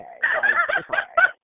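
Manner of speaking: high, loud laughing it's all right; brushing it off in a squeaky laugh; laughing fake reassurance; mock reassuring. Mock reassurance after baby falls.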